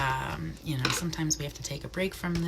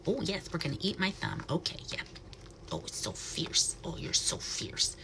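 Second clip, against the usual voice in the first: hushed, gentle pet talk; soft cooing to bird; 8 dB quieter than usual. Real, not acted. Keeper coos softly to the owl on her glove.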